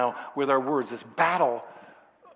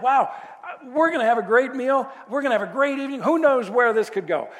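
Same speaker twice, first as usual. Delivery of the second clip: higher and louder, eager and thrilled; gushing about the big evening ahead; excited; 7 semitones higher, 6 dB louder than usual. Retold or imitated. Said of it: "I'm getting kind of excited".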